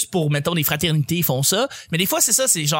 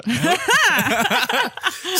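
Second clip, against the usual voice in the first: laugh; 13 semitones higher than usual. Real, not acted. Host laughs at guest joke.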